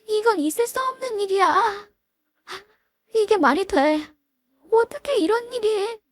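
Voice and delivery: high, strained wailing denial; acted crying. Friend sitting on the ground after her police husband shows up, high wailing cry. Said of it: "지연이가 바닥에 주저앉아 울기 시작했습니다".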